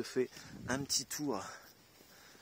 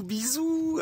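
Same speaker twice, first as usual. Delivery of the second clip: bisous leaps much higher in a sing-song; 5 dB louder than usual. Retold or imitated. Cashier's second bisous, mocked high and sing-song. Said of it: "après, elle me fait".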